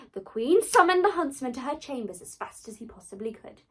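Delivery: ordinary delivery, nothing marked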